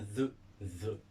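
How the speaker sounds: "the" with a voiced English th; English th sound. Shown as a demonstration. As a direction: say "the" with the tongue between the teeth, as in English. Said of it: "en anglais, on peut le dire".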